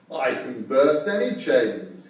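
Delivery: slow, boastful wolf voice; proud. Acted. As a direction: puff up and boast it slowly. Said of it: "he told them proudly".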